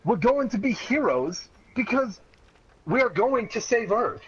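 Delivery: high, bold hero promise; rally them like a hero; proud high heroic vow; heroic declaration. Acted. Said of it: "I said".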